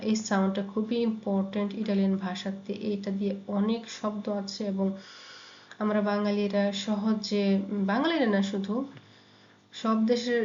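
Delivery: ordinary talk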